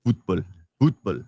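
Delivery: low Russian-accented single word. Shown as a demonstration. Demonstrating for the co-host, low Russian accent. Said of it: "do it in a Russian accent".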